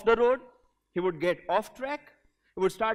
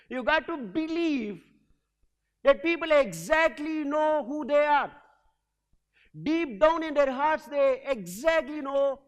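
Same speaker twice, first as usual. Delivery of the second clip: slow emphatic preaching; 4 semitones higher, slower than usual. Acted. Preacherly message.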